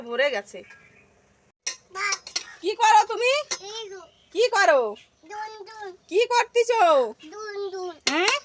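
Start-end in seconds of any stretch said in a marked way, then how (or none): 2.6-8.3 teasing high call. Real, not acted. Mother asks her toddler what he is up to, high and teasing.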